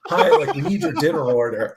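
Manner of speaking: quoting the cafeteria caller while cracking up; laughing through quote. Retold or imitated. Telling the host about the absurd hospital call, laughing through it. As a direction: giggle through the order request. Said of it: "And they're like".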